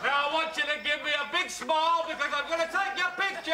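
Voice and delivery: high, loud shout as if to someone deaf. Acted. Man asking a woman he thinks deaf to pose, shouting. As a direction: bellow it so she can hear. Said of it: "Will you please stop shouting?".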